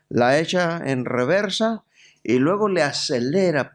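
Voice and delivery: normal talking voice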